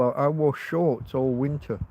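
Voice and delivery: ordinary talk, nothing marked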